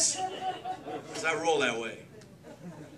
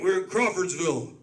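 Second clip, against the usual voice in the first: ordinary talk, nothing marked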